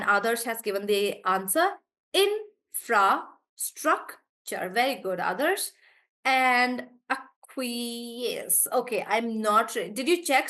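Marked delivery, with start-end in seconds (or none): 2.1-4.7 "Infrastructure" very slow and higher, in-fra-struc-ture; long gaps between the beats of "Infrastructure"; syllable by syllable. Shown as a demonstration. Teacher giving a student's answer, syllable by syllable. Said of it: "we are just breaking words". 7.1-8.7 "acquiesce" very slow, drawn out as ac-qui-esce; syllable by syllable. Shown as a demonstration. Teacher unsure of the syllable count, sounding out syllable by syllable. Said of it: "the syllables for this".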